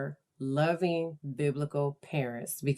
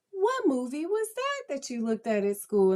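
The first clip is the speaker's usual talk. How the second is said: put-on high, sweetly curious voice for a child; lilting, interested mom question; smiling parent; 10 semitones higher than usual. Shown as a demonstration. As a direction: ask it with a big audible smile. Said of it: "smile and say".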